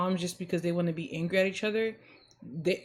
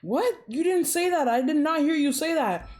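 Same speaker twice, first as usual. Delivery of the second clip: defensive retort; 8 semitones higher, 3 dB louder than usual. Retold or imitated. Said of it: "the other person's like".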